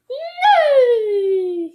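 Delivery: very high loud neigh; whinny like a real horse. Pretend. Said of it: "he whined".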